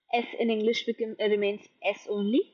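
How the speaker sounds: ordinary talk, nothing marked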